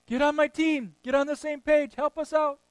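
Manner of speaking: high, loud frazzled demands; mock pleading shout. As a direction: beg the husband to help, half-shouting. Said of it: "They grab their husbands and say".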